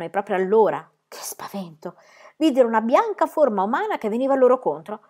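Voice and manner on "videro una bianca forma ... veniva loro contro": plain reading voice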